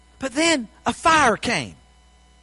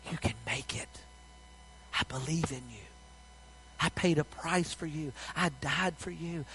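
soft and lower, reassuring; tender divine voice; 5 semitones lower, 15 dB quieter than usual. Acted. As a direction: speak like God comforting a hopeless heart. God's voice speaking inside you.

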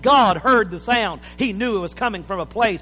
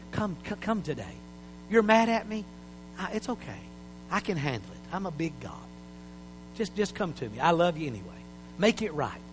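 much softer, gentle coaxing voice of God; tender God voice; 8 dB quieter than usual. Acted. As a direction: call them home softly, like a patient father. Preacher voices God pleading.

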